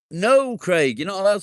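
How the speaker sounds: loud, high joking no; mock telling-off. Real, not acted. Streamer jokingly refusing a viewer, loud mock telling-off. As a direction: bark a playful no at him.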